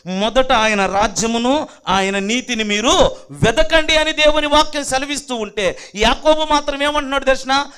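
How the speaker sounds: loud, driving sermon delivery; loud pulpit proclamation; full-force preaching. Real, not acted. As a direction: preach it out to the congregation.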